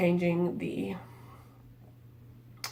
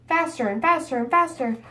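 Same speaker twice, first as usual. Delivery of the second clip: fast, rushing ahead; speeding up; 5 semitones higher, faster than usual. Shown as a demonstration. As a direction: race through it faster and faster. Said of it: "I continued to speed up and I got really fast".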